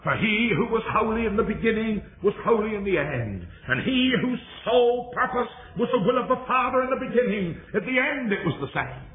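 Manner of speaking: high, ringing sermon delivery; impassioned preaching. Real, not acted. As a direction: preach it in a high, sustained declamation. Preaches at full force.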